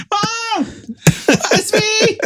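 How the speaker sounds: high, desperate pleading; calling mom. Retold or imitated. Son calling out to his mom at the flashlight. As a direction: beg mom to claim him.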